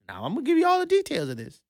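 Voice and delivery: ordinary talk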